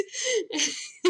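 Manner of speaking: high-pitched helpless laugh; high squeal laugh. Real, not acted. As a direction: burst out laughing. Laughing at own story.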